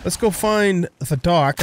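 ordinary talk, nothing marked

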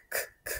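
voiceless k, k barely heard; whispered k sound. Shown as a demonstration. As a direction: click out k, k softly. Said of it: "sound k".

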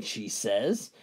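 ordinary talk, nothing marked

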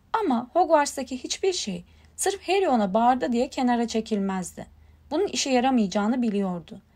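usual speaking voice

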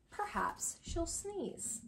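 slow, soft teasing refrain; slow hushed suspense line. Acted. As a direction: drop it down and draw it out for the kids. Refrain read slowly, softly.

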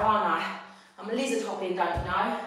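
normal talking voice

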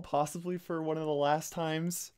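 high, loud, upbeat intro shout-out; channel intro voice. Acted. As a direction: kick off the video like a hyped host, then let it sag.

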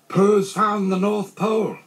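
high, loud, drawn-out proclamation; proud announcement. A narrator's reading. Christopher Robin announces the discovery.

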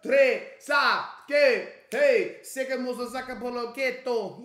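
high, punchy fake Spanish; mock Spanish. Reactor joking about Mexican night at clubs, in mock Spanish. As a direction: throw out Spanish-sounding nonsense in a put-on accent.

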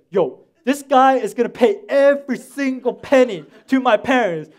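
loud, high retold tirade; pissed-off rant. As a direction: reenact laying down the law, loudly. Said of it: "I was so pissed off".

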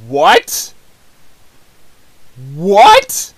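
loud, high disbelieving "What?"; shocked what. Real, not acted. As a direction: blurt it out in stunned disbelief. Shocked by line in old video.

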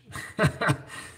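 airy giggle up high; high breathy laugh. Real, not acted. Mechanic laughing after ribbing his friend Ray, airy laugh. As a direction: chuckle at your own joke.

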